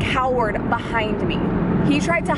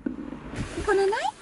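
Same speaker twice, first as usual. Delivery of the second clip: slow, soft coo to a drowsy dog; slow tender murmur to her sleepy dog; 7 dB quieter, slower than usual. Real, not acted. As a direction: hush him gently to sleep.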